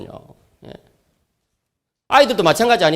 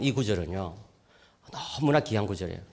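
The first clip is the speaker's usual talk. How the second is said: ordinary delivery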